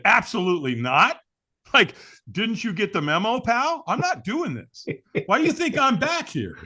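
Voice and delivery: high-pitched, incredulous brush-off; indignant refusal. Retold or imitated. Refusing a stranger's invitation to walk, mock indignant.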